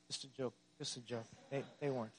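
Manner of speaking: very soft, low throwaway aside; sheepish aside. Real, not acted. Pastor admitting the Chris Paul rumor was a joke, hushed low aside. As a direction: drop right down to quietly take the joke back. Backing off his own trade joke.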